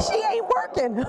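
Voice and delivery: high mock voice; squeaky joking voice. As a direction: tease yourself in a squeaky voice. Jokes in a high put-on voice.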